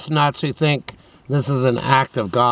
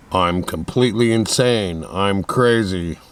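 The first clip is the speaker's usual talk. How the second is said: deep put-on voice; 5 semitones lower than usual. Shown as a demonstration. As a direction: push the voice down low and drag it. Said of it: "if my voice was like this".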